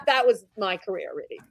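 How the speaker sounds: ordinary talk, nothing marked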